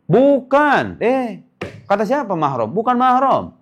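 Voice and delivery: high-pitched, incredulous denial; high, insistent contradiction; emphatic correction. Real, not acted. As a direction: push back in a high, protesting voice. Correcting listeners who think cousins are mahram.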